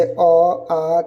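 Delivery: letters अ and आ drawn out very slowly; slow recitation. Shown as a demonstration. Teacher reciting the letters for students, very slow. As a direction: spell the letters out one by one, slowly. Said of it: "हम बता देते हैं एक बार".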